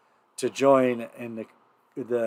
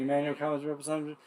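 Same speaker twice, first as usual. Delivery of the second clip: fast and soft, under the breath; hurried run-through; 10 dB quieter, much faster than usual. Real, not acted. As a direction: rattle it off quietly to jog the memory. Running through lyrics to recall ending.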